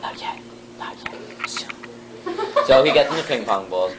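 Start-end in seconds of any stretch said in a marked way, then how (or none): 0.0-1.7 slow, mysterious whisper; secretive whisper. Acted. Son putting dad off once more, whispering slowly.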